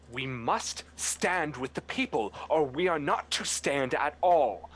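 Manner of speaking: rising into a rallying shout; yelling owl. Acted. Owl noble yelling to the crowd. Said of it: "as they're yelling out".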